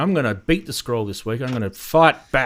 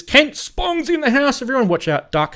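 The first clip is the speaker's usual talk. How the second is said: announcer hype; 12 semitones higher, 6 dB louder than usual. Real, not acted. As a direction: announce him like a ring entrance. Announcing a guest arriving.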